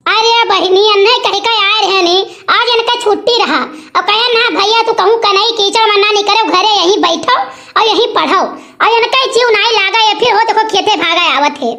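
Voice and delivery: usual speaking voice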